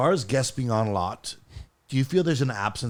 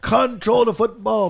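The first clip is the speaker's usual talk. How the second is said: put-on slow, loud accented shout; hammed-up Jamaican accent; 10 semitones higher, 6 dB louder, slower than usual. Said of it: "That is Jamaican".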